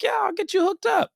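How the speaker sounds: high, breezy voice for the friend; friend's voice. Retold or imitated. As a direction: play the easygoing friend, pitched high. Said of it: "He's like".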